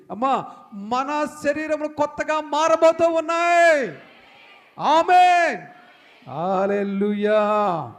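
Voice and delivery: shouted proclamation. Real, not acted. Preacher rousing the congregation, full shout. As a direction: peak of the sermon, belt it out.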